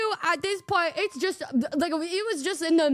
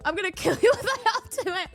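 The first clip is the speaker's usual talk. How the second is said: laughing threat; 5 semitones higher than usual. Real, not acted. Joking threat while laughing.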